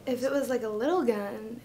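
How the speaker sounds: usual speaking voice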